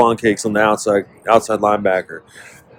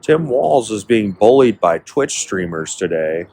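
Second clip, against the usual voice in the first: ordinary delivery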